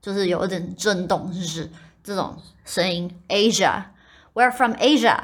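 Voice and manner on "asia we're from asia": slow, Asia with a voiced "zh"; careful model sentence; native Asia. Shown as a demonstration. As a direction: say "we're from Asia" slowly, voicing the s. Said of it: "比较道地的念法是Asia".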